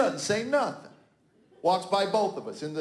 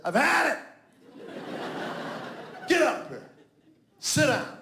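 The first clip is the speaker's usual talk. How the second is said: fed-up dad yelling; 6 semitones higher than usual. Retold or imitated. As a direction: bark it like a fed-up father. Father lays down the law.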